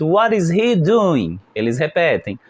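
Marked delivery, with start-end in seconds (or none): 0.0-1.4 What is he doing, drawn out slowly; slow model question. Shown as a demonstration. Teacher models the question for students, slowly. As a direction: say it slowly for them to repeat. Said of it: "So let's repeat".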